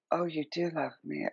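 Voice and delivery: hushed, tender mock gratitude; quiet, melting little aside; softly touched. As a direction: say it small and soft, as if moved. Acting someone touched by home cooking.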